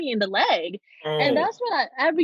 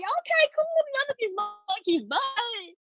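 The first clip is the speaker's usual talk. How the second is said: high, slow, overly trusting voice; naive mock relief; 12 semitones higher, slower than usual. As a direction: sound blissfully clueless, pitched up high.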